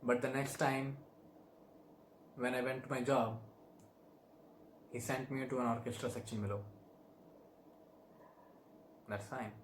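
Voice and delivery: quietly resigned, dropping away; deflated punchline. Acted. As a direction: let it fade down to a small resigned close. Monologue's deflated punchline about demotion.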